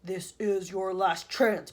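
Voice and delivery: slow, loud, menacing boom; booming warning. A narrator's reading. Alien voice warning the hotel visitors. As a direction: lay down a final threat. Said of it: "boomed the voice".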